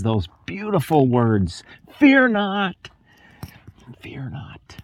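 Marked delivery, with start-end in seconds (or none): 2.0-2.8 lifted, tender call of fear not; Jesus gently calling to his frightened disciples; gentle Jesus voice. Acted. 4.0-4.8 fear not, breathed in a soft whisper; Jesus whispering comfort to the disciples in the boat. Acted. Jesus's words repeated, hushed.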